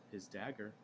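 creepy whisper. Pretend. Fain voiced with his dagger.

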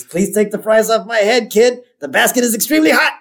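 high-pitched urgent plea, laughing through it. Retold or imitated. Mo to a kid about hot fries, high comic plea. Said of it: "And he says".

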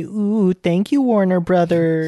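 slow, drawn-out mock gratitude; mock grateful fan. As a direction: gush thanks like a grateful fan. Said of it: "And we'll say".

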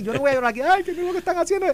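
high, hammed-up whimper as if about to cry; mock whine. Pundit mock-whines to cohost about rivals' legal move. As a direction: play at crying over the law, not for real.

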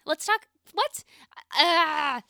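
high, loud what; exasperated. Real, not acted. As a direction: cry out in disbelief. Exasperated disbelief at missed chance.

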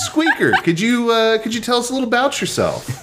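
high squeaky interviewer voice; squeaky teasing voice. Acted. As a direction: tease her in a high squeak. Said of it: "I have lost my voice".

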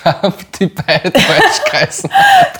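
hoch auflachend gesagt; lachend. Real, not acted. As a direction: kaum vor Lachen sprechen können. Lachen über Bandnamen.

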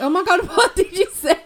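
loud, high laughing disbelief; cracking up at a talking squirrel; laughing shock. Pretend. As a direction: blurt it out through laughter.

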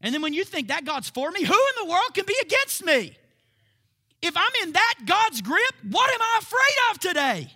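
very high, very loud fervent preaching; rally the crowd with fearless fire; shouting the triumphant questions; preaching at full force. Acted. Preaching climax.

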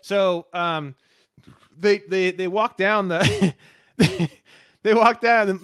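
ordinary delivery

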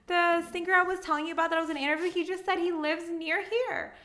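imitating her own high-pitched fangirl gushing; drunk fangirl. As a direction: gush to your babe like a tipsy fangirl, voice way up. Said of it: "the fangirl inside me, I'm drunk".

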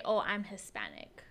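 normal talking voice

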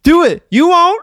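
high, loud taunting dare. Pretend. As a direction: goad them into it. Daring friend to drink water.

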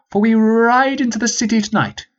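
a high, formal commanding officer; rally the troops like an old sergeant; sergeant's voice. Acted. Peabody's speech voiced high.

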